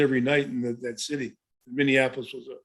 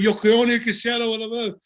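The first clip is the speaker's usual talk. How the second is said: Spanish rant; 7 semitones higher than usual. Retold or imitated. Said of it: "he'd break into the, the Spanish".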